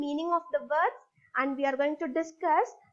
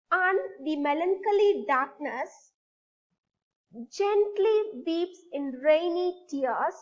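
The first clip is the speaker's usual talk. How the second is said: poem recitation. Shown as a demonstration. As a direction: lead the class line by line. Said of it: "Now read the line".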